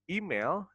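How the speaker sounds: "Email" opening on a long ee; correct "email" pronunciation. Shown as a demonstration. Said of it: "nah ini dibacanya email".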